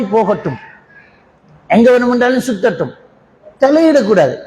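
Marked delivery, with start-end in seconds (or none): none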